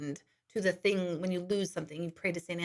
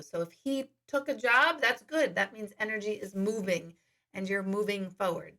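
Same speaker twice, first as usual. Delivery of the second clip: ordinary talk, nothing marked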